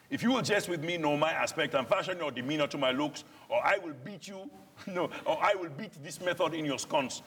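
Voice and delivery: put-on a threat in Nigerian-accented Shakespeare, pitched high; Nigerian-accented verse. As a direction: warn him in Nigerian-flavoured verse. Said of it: "we're foreigners speaking this".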